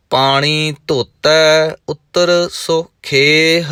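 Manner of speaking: slow, measured chanting recitation; gurbani recitation. Acted. Teacher reciting Japji Sahib to students, measured chant. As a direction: intone each line with devotion.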